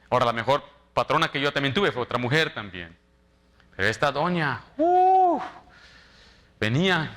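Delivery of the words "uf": high, loud exasperated "uf". Real, not acted. Pastor remembers his difficult boss, loud exasperated uf. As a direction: blow out a big pained "uf".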